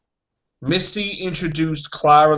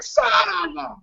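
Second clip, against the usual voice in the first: high-pitched exasperated cry; comically outraged outburst; mock furious cursing; 12 semitones higher, 4 dB quieter, slower than usual. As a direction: squeal the curse in frustration. Re-enacting reaction to the spoiler.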